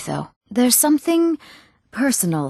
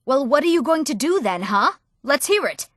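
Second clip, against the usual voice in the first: higher-pitched, testing challenge; pointed, expectant questioning; challenging; 6 semitones higher than usual. Acted. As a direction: demand an answer, pitched up. Challenging someone to speak up.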